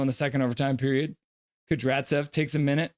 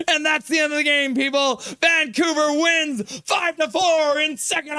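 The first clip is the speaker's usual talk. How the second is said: ecstatic goal call; 12 semitones higher than usual. Real, not acted. Overtime winner goal call.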